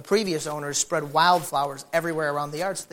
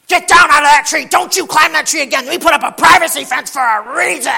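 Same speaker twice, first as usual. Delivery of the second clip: higher, louder scolding shout; angry neighbor yelling; 9 semitones higher, 12 dB louder than usual. Retold or imitated. Neighbor shouting at his daughter in the tree. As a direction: yell at the kid to get out of the tree. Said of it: "neighbor yelling at daughter".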